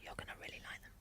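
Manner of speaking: conspiratorial stage whisper. Acted. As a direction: lean in and whisper it like a secret. Playful aside about Santana.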